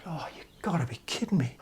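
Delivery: whispered, disbelieving groan of words; soft airy whisper of disbelief; hushed dismay. Retold or imitated. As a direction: say it under your breath, mortified. Retold dismay at his blunder.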